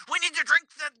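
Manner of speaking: dumb fan voice. Retold or imitated. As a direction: play an eager fool urging everyone to drink. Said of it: "somebody's like".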